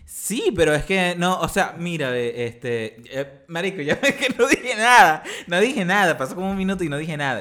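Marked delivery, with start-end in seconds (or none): 3.8-6.2 high, loud exasperated outburst; laughing protest. Real, not acted. Podcaster admits to friend the attempt failed, loud laughing protest. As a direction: protest loudly through a laugh.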